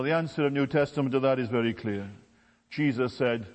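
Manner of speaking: ordinary talk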